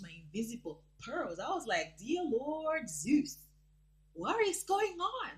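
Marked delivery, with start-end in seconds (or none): 2.0-5.3 higher, slow, stunned gasp of disbelief; mock shock. Vlogger reliving her shock at the video, mock alarm. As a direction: clutch your pearls in horror. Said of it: "I was scared".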